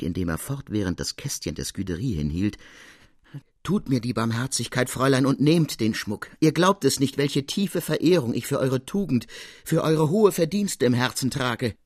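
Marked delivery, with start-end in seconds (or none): 3.6-11.7 beside himself. Acted. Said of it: "sprach wie außer sich".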